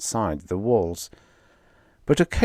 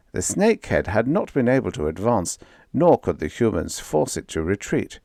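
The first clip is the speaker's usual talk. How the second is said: usual speaking voice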